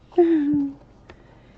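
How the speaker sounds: loud high burst of laughter; sudden loud laugh. Real, not acted. Laughing at Amanda Mom.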